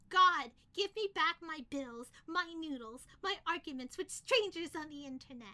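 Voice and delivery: crying out to the heavens; loud desperate plea. Acted. Begging plea to god.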